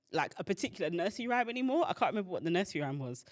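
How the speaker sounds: normal talking voice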